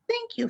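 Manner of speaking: a bright high thank you; sweet high thank you. Retold or imitated. Quoting advice to viewers.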